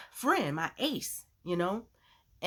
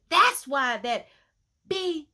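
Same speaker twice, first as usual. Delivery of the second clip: retold angry yell; 8 semitones higher, 7 dB louder, slower than usual. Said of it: "So she started yelling".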